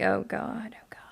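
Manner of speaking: hushed, breath-only aside; mock-despairing whisper; whispered dismay. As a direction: whisper it to yourself in retold dread. Retold dismay at the boss.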